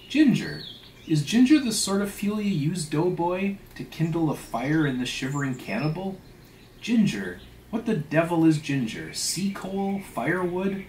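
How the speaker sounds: scornful, high-pitched questioning; indignant. Acted. Stubb berating the steward over ginger, indignant. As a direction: fire off incredulous questions, punching the key words.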